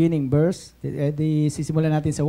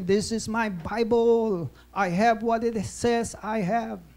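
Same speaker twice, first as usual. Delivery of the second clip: slow, higher-pitched recited declaration; Joel Osteen; 4 semitones higher, slower than usual. Retold or imitated. Preacher leading the church in his Bible confession, slow declaration. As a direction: draw out each phrase like a congregational creed. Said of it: "Ano sabi ni Joel Austin?".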